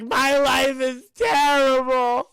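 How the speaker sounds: high, loud, drawn-out mock wail; hammed-up, slow sobby whine; mock tearful breakdown. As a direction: imitate someone breaking down in tears. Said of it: "she starts breaking down in tears".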